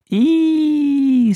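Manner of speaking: high, loud "eee" squeal; children's squeal. A narrator's reading. Children react to the witch game, squealing. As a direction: squeal like a roomful of spooked children. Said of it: "said the children".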